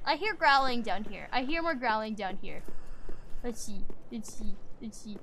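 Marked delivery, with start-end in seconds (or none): none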